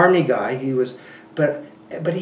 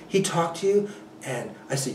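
ordinary delivery

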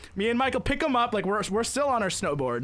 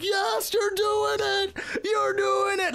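high, drawn-out mock cheer; imitated cheering; 12 semitones higher, slower than usual. Parents and kids cheer the skier on, high excited cheering. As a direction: whoop encouragement like a crowd of onlookers. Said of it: "all the parents were cheering".

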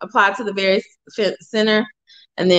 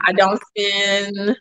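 slow, deliberate phrasing at usual pitch; drawn-out slow; much slower than usual. Real, not acted. Host starting a disclaimer about spending, slow. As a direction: stretch the words out slowly. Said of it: "say it slow every time".

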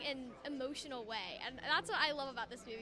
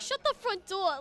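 loud, higher-pitched Brooklyn accent; 3 semitones higher, 7 dB louder than usual. Shown as a demonstration. Showing off the accent that annoyed my brother, Brooklyn accent. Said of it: "just annoyed my brother with it".

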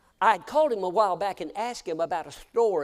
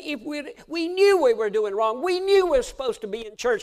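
louder, higher anguished admission; grieving confession; 9 semitones higher, 10 dB louder than usual. Retold or imitated. Grieving wife confessing to her pastor, louder and higher. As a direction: plead it out in regret. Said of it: "I heard her say".

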